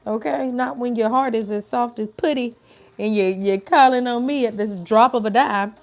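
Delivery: a loving sassy scolding; a hint of a sassy auntie voicing God. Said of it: "God is like".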